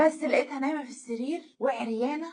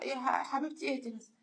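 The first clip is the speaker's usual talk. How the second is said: hushed, soft calming plea; soothing husband; 10 dB quieter than usual. Retold or imitated. Husband calming his screaming wife, soft and soothing. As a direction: quietly settle someone in hysterics.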